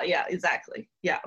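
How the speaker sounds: usual speaking voice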